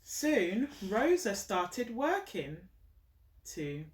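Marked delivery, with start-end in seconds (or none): none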